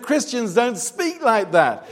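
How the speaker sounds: high-pitched, re-enacted scolding; scolding rebuke. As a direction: tell her off, acting out the quarrel. Acts out his scolding of woman.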